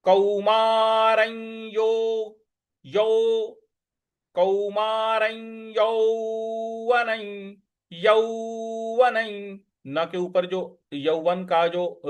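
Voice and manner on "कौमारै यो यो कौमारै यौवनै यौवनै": कौमारै यौवनै very slow, endings gliding like इया; slow glided endings. Shown as a demonstration. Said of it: "इया की तरह ले आना है".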